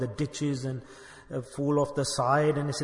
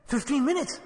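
astonished question; 9 semitones higher than usual. Acted. Amazed traveller in the parable.

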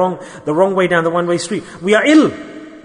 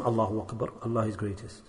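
hushed awe; 9 semitones lower, 15 dB quieter than usual. Real, not acted.

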